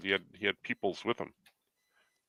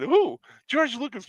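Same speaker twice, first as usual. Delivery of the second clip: much higher and louder eager question; mock excited friend; 7 dB louder, slower than usual. Fan retelling friend's excited reaction to the news. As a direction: play the friend thrilled.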